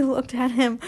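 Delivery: plain reading voice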